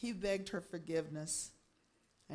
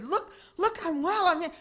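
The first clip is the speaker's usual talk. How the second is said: high, loud, joyful outburst; excited; 9 semitones higher, 8 dB louder than usual. Acted. Healed husband running to his wife, excited. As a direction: call out in elated excitement. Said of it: "He was so excited".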